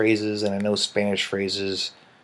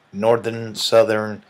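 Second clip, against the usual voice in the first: normal talking voice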